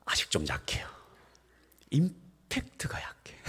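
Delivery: whispered, hesitant critique. Retold or imitated. Elder hesitantly critiquing the pastor's sermon, whispered. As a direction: break the bad news softly. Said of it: "뭔가 말하려고 머뭇거리시".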